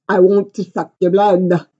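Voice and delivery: lightly voiced playful vampire; a hint of a hammed-up Dracula; teasing vampire line, lightly put on; mock vampire. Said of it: "the vampire in you".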